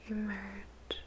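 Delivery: ordinary delivery, nothing marked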